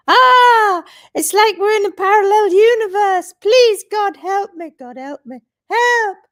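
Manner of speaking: high, loud hammed-up despair; mock despair. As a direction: wail it like you are doomed. Mock despair over farrier advice.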